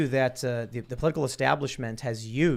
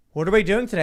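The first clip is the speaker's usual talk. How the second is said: bored kids mocked; 6 semitones higher, 8 dB louder, much faster than usual. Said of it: "young people who are like".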